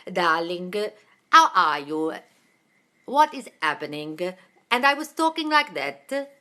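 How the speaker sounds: slow, theatrical Italian woman's accent. Shown as a demonstration. Actor demonstrating an old stage role, Italian accent. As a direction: draw the words out in a hammed-up Italian accent. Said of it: "I played a role once of an Italian woman in her fifties".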